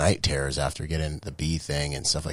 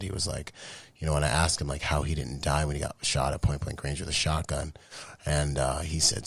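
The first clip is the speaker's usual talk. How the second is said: ordinary delivery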